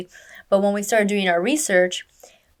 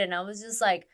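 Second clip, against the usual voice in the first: ordinary delivery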